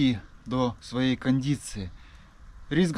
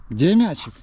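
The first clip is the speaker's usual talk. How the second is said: talking to a dog; 4 semitones higher, 6 dB louder than usual. Real, not acted. Asking dog, pitch up.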